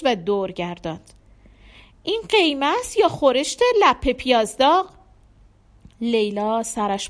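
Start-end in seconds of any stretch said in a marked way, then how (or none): 2.0-4.9 louder, higher, indignant question; irritated complaint. A narrator's reading. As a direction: complain sharply about the food. Husband angrily complains about dinner.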